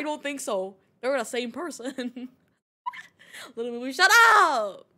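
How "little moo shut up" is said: mock outraged yell. Streamer scolding chat over a nickname, mock yell. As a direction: holler in playful protest.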